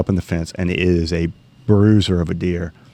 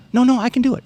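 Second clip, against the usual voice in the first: put-on high pleading kid voice, much higher; faster than usual. Son begging dad to let him shoot, high eager kid voice.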